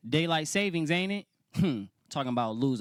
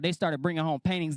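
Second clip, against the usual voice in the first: usual speaking voice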